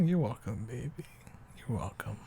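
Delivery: soft whispered reply. Acted. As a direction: whisper it back tenderly. Tender reply in bed.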